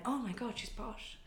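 shocked producers. Retold or imitated. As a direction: murmur it like an appalled executive. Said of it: "they went".

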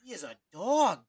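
loud, high burst of admiration; excited hype. Real, not acted. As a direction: punch it out high and loud, hyping a player. Hyping a prospect he admires.

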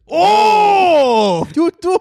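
put-on very high, loud Japanese-accented whoop; surprised Japanese impression. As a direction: exclaim it like a hammed-up astonished Japanese man. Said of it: "like a surprised Japanese person".

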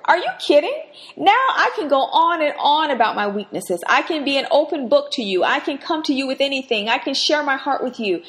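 Paul can't contain his delight; overjoyed apostle gushing to the Lord; excited Paul. Acted. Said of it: "this is Paul talking".

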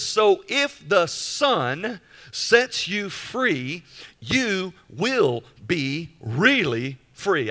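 slow, weighted verse reading, word by word; slow emphatic reading. Real, not acted. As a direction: read the verse slowly, weighing every word.

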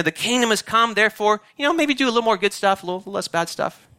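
lightly voiced lukewarm advice, fading at the end; mock casual pitch. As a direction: shrug it off like it barely matters. Mocking a watered-down message.

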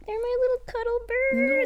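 very high cutesy pet voice; doting. Real, not acted. Owner gushing about pet birds, doting coo. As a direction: coo over your little pets.

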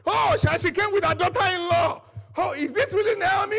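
loud and high, crowd-like excitement; excited town shout. A narrator's reading. Townspeople greet Naomi's return, shouting excitedly. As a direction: cry out like the whole town welcoming her. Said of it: "Everybody was shouting".

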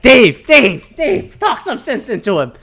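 high, loud calling of a name, easing into a plea; acted desperate appeal to a friend; urgent mock call-out.

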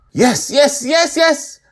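very high, loud squeal of delight; thrilled child. A narrator's reading. Joy seeing the flag come alive, thrilled squeal. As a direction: cheer like a kid who got her wish. Joy sees dragon move.

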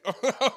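quick high burst of laughter; a short, bright laugh at his own joke. Real, not acted.

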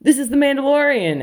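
a lightly hammed-up proud parent introducing a star; excited parent. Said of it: "their parents will meet me and be like".

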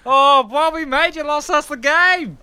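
very high, loud Aussie wail; Australian accent. Acted. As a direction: howl at your teammate in an Aussie accent. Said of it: "the Aussie way".

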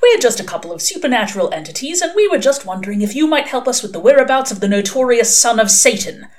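loud, lilting patter; sing-song voice. A narrator's reading. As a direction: rattle it off in a mock-polite sing-song. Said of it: "in a sing-song voice".

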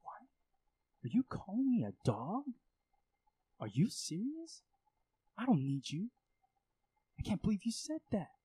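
high, indignant, disbelieving retort; offended outburst. Pretend. Believer, insulted by Jesus' answer, snaps back in high indignant disbelief. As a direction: sound insulted and incredulous.

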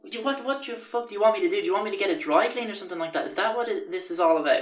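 high, fast, incredulous questioning; acted-out rapid exasperation; exasperated retort. Retold exasperated retort to housemate.

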